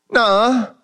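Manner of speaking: high squeaky denial; disbelieving dad. Retold or imitated. Father refusing to believe the gift, high and squeaky. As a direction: squeal a childlike no way.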